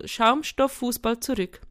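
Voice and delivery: ordinary delivery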